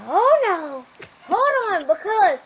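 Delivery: loud, high-pitched imitation of an alarmed mom; panicked mother. Mother on a night phone call to her daughter, panicked.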